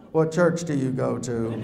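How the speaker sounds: low, slow, suspicious question; a hint of a gruff local; lightly voiced Texan. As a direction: lean in low on the word church. Caller interrogates newcomer.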